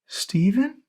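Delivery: put-on high, hesitant old-woman voice asking his name; a frail grandma unsure who stands before her; soft old grandmother. A narrator's reading. Grandma seeing her grandson, high wondering old voice. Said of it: "she asked softly".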